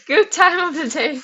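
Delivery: Good time of the day said through a real laugh. Real, not acted. Greeting the iPad guy, laughing. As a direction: laugh while you say it.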